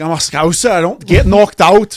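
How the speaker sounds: put-on a light Liverpool accent; mock Scouse accent. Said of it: "the Scouser shit".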